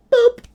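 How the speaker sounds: very high, loud cartoon boop; mock phone boop. Streamer mimics Trump's phone call to Charlie with a very high, loud boop. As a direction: pop it like a call connecting.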